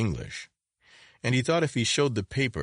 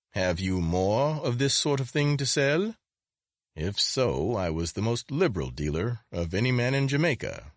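curious shopkeeper angling to buy, pitched up; lilting, higher foreign-accented trader; foreign-accented dealer Solomons; 6 semitones higher than usual. Acted. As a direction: make an eager sales pitch in a foreign accent.

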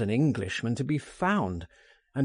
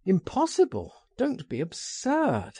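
quick higher-pitched retort; 7 semitones higher than usual. A narrator's reading. Frank dismisses his friend's doubts with a sharp retort. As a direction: throw the objection straight back. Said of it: "I retorted".

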